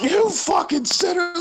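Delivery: very high, stretched-out cry; hammed-up righteous condemnation, slow and piercing; wrathful Jesus. Hallucinated Jesus cursing at stoned kids, mock wrath.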